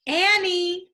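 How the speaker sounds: high loud call across the hill. Acted. Jack calls after Annie as she runs off, high loud call. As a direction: call her name across the hilltop. Calling to sister across hilltop.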